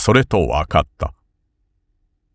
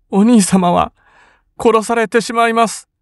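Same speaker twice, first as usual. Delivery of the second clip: fearful sister; 3 dB louder than usual. A narrator's reading. Sister fears brother's death.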